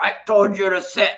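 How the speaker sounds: mock drill-sergeant yell at the dog; stern yelled command. Shown as a demonstration. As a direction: snap the order at the dog. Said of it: "yelling or your voice needs to be like this".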